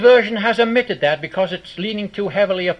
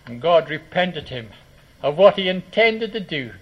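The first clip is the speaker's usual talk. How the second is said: normal talking voice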